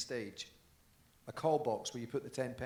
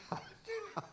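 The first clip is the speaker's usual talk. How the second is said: said through real laughter, higher; laughing; 14 semitones higher than usual. Real, not acted. Telling the host how he was fooled, laughing. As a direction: laugh it out at his own mistake.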